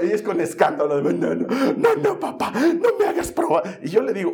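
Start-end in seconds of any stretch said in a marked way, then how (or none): 1.0-3.8 high-pitched whiny plea with a laugh; child pleading. Retold or imitated. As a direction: beg like a little girl, way up high. La hija suplica no probar. (niña suplicando, voz aguda)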